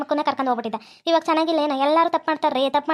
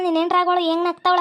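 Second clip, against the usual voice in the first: usual speaking voice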